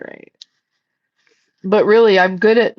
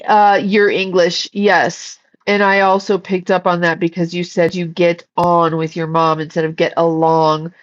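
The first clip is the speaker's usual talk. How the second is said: usual speaking voice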